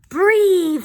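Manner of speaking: high, loud dragon roar of a word; dragon father. Acted. Dragon father to his little dragons, roaring. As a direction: blast it out like a dragon.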